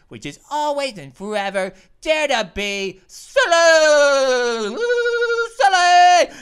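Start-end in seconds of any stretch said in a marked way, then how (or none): 2.0-6.4 loud, high, long-stretched goofy shout; silly catchphrase yell. Pretend. Gamer signing off to viewers, hammed-up silly shout. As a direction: stretch out silly into a big playful holler.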